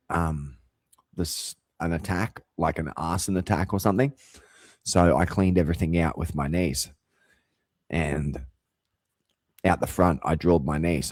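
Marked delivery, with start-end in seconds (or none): none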